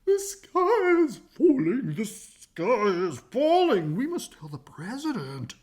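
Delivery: high, slow, loud panicky hen; clucking hen. Acted. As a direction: squawk it out like a frantic chicken.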